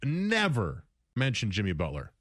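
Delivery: ordinary delivery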